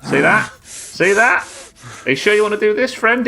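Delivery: cocky taunt. Real, not acted. Gamer taunting an opponent mid-fight, high cocky challenge. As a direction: goad the enemy with rising questions.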